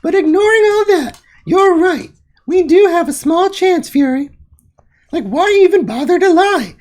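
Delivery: high, loud gloating squeaky voice; mock Celeste voice. Streamer voicing Celeste's boastful chat in a high loud mock voice. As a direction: pitch it way up and mock the rival.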